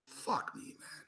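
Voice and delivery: breathy whispered curse after a sigh; despairing whisper. Real, not acted. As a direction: let it out in a defeated whisper.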